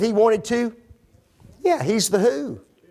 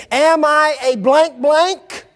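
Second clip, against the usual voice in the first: slow, high, louder indignant question; indignant Abner; 8 semitones higher, 5 dB louder, slower than usual. Acted. Abner to Ishbosheth, offended, slow and loud. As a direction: draw out the insulted question slowly. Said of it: "Who talks like that?".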